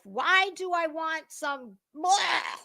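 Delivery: normal talking voice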